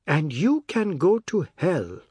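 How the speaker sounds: clear, deliberate, icy rebuke; cold, firm. A narrator's reading. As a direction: space each word evenly and firmly. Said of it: "were cold and firm and clear".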